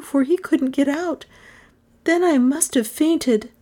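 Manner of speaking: put-on high, haunted girl's voice; reliving the fear as she tells it; frightened young girl. A narrator's reading. The girl tells the detective what she saw, high and haunted. Girl recounts her terror.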